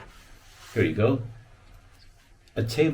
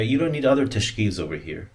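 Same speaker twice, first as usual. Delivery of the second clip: ordinary talk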